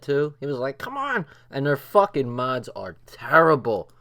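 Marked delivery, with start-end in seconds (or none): 0.8-1.3 mock frustrated cry. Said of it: "He was getting so mad".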